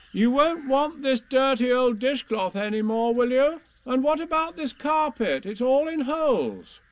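high, brisk motherly Kanga voice; bustling kangaroo mother calling. A narrator's reading. Kanga calls up to Owl while tying things on, high and brisk.

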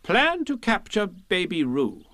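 high, slow, official reading; Rabbit reading out. A narrator's reading. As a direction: read the plan importantly. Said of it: "what Rabbit read out".

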